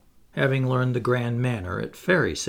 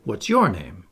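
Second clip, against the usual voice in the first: a lightly voiced girl, a touch lower, stress on your; girlish question; 4 semitones lower than usual. A narrator's reading. Wendy returning the question to Peter, hint of a girl. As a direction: turn it back on him with stress on your.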